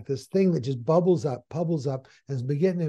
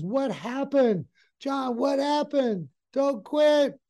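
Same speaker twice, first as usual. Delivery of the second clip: higher, slow and pleading; a worried friend begging him to stay; alarmed buddy; 9 semitones higher, slower than usual. Retold or imitated. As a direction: coworker begging John not to quit, alarmed and high. Buddy pleads as John quits.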